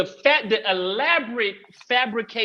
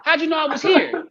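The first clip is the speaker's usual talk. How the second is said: retold disbelief; 6 semitones higher than usual. Asking son in disbelief.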